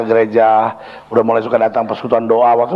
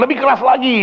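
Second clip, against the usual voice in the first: high-pitched retold call for more volume; urgent urging; 10 semitones higher than usual. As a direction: urge the singers on, pitch raised. Retold urging singers to sing louder. (seruan mendesak bernada tinggi)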